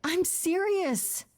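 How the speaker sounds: drawn-out, high-pitched insistence; exasperated protest. Acted. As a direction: stretch it out high, protesting the teasing. Protesting at friend's teasing banter.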